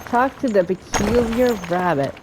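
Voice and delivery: normal talking voice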